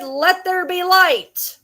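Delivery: high, loud and slow like a booming decree; a mock-biblical pronouncement as the light returns; grand proclamation. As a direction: announce it like a divine decree. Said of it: "And they said".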